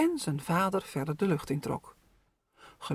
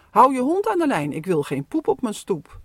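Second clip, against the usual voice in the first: louder cranky neighbour rant; grumpy scolding; 4 semitones higher, 7 dB louder than usual. Acted. Strict neighbour scolds about dog mess, loud and grumpy. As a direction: scold the dog owner sternly.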